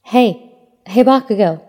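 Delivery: eager call after a friend. A narrator's reading. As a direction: shout to catch his attention. Calling after him down hallway.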